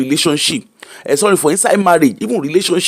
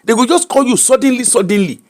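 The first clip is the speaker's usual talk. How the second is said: ordinary talk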